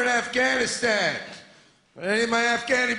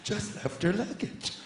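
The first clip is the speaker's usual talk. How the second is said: a hushed, sinking voice of a scared passenger, much lower and softer; mock fearful whisper; 5 semitones lower, 4 dB quieter than usual. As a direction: whisper the alarm like a nervous traveller. Panicked traveller spotting luggage.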